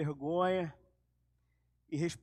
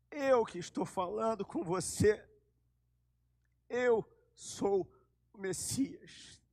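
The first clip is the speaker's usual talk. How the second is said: solemn reveal; slower than usual. Acted. Jesus reveals himself, slow soft.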